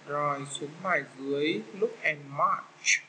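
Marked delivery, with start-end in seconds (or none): 1.8-3.0 slow instruction. Shown as a demonstration. Teacher reading the exercise title very slowly to first graders. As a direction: look and match, drawn out very slowly. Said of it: "teacher reads instruction slowly".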